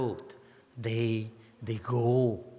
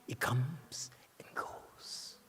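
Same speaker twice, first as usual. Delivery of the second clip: very slow, soft whisper; whispered, drawn out and fading; hushed whisper; 10 dB quieter, much slower than usual. Real, not acted. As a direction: let it drift away in a slow whisper. Hushed punchline on impermanence.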